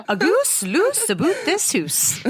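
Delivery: high, slow, laughing through words. Real, not acted. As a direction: giggle it out slowly. Laughing rhyme joke.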